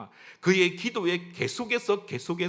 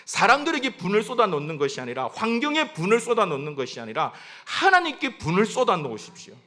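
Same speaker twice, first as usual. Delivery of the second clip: ordinary delivery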